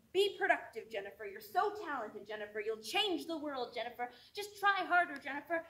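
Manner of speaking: imitated pushy elders, swinging in pitch; insistent mock scolding, building in urgency; nagging elders. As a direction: press each demand, then tag on the name. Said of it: "voices of society and my elders saying".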